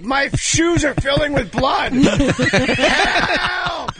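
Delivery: high, slow, shouted mock panic. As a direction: cry for help in hammy terror. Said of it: "and you're like".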